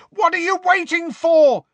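high, loud bellowed order. A narrator's reading. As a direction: bellow it at the crowd. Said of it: "Harry bellowed".

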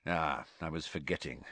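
low, soft dry teasing; a sly jab, quietly; Moody needling Dumbledore; sardonic. A narrator's reading. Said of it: "with a sardonic smile".

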